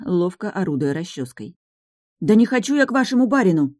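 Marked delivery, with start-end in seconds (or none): 2.2-3.7 loud, high angry outburst; explode, shouting. Acted. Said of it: "наконец-то взорвалась я". (взрываясь, на крике)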